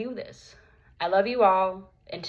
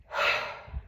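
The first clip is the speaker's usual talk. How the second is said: long breathy sigh; frustrated sigh; 6 dB quieter than usual. Real, not acted. Frustrated pause after venting.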